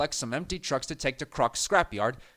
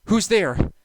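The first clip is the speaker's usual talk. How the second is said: ordinary delivery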